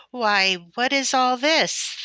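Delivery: high, loud and slow astonished call; surprised cry. A narrator's reading. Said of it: "the hunter cried".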